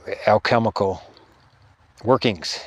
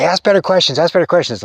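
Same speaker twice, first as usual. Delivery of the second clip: stern urging; 7 semitones higher, 6 dB louder than usual. Retold or imitated. Spirit scolding him at night.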